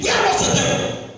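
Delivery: high, loud rhetorical question; rising cry. Acted. Preacher demanding of the congregation, high and loud. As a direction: hammer the question at the church. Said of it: "repeated question".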